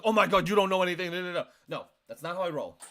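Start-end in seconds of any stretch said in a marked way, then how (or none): 0.0-1.1 loud, rushed mock outburst; mock attack. Showing how they wouldn't argue, a loud mocking attack. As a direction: come at them fast and loud, mockingly. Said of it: "I'm not gonna come at you like".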